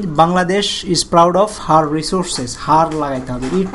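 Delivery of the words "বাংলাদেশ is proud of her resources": slow dictation for the class; slow example sentence. Shown as a demonstration. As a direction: dictate বাংলাদেশ is proud of her resources very slowly. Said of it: "যেমন যদি বলি".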